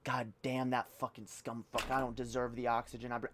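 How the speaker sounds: mock him quietly and low; quiet mock muttering. Mocking troll talking to mirror.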